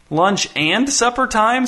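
slow, high-pitched disbelief; indignant kid, drawn-out words; incredulous. A narrator's reading. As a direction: question it with appalled emphasis on "and". Child appalled at being confined.

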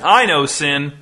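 "I" pushed up in pitch and volume; stress on I. A narrator's reading. Man in front row answering the pastor, stressing "I". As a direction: claim it with weight on "I".

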